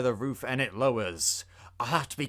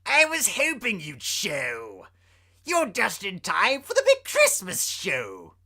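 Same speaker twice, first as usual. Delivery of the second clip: higher, teasing villain; mocking clown drawing it out; Joker voice; 7 semitones higher, 3 dB louder than usual. Joker taunts Batman.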